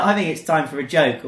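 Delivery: usual speaking voice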